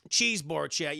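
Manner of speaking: ordinary delivery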